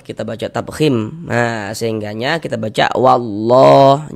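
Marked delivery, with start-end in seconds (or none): 1.3-4.1 "wallah" said slowly with a full, heavy "llah"; thick Allah. Shown as a demonstration. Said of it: "lafaz Allahnya kita baca tebal". ("wallah" dibaca tebal)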